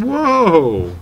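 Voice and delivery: high loud startled yelp; startled whoa. Retold or imitated. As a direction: jump back in shock. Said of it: "I was like".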